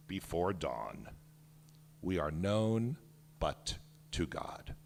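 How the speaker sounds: slow, grave storytelling, voice sinking at line ends; solemn book reading. Acted. Author reads his picture book to the audience, slow and solemn. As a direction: read as the fallen soldiers speaking, hushed and mournful.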